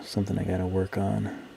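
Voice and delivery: usual speaking voice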